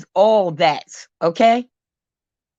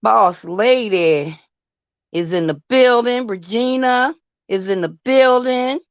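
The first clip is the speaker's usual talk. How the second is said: hype roll call. Real, not acted. Shouting out viewers.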